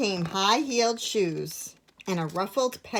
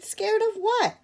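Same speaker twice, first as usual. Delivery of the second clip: high scornful question; mock her fear; 3 dB louder than usual. A narrator's reading. Little bear scoffing at edith, high and scornful. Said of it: "Little Bear snorted".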